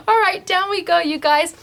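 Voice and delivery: ordinary delivery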